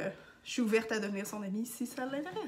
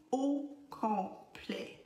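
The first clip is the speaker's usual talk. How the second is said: AU COMPLET drawn out very slowly, syllable by syllable; emphatic; 2 semitones higher, 3 dB quieter, much slower than usual. Real, not acted. Teacher asking viewers to watch the whole video, emphatic. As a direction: stretch out each syllable of AU COMPLET.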